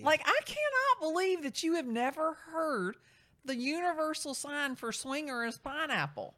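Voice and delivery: loud, high, amazed outburst; real amazed disbelief; incredulous exclaim. Real, not acted. Amazed he doesn't know pineapple sign.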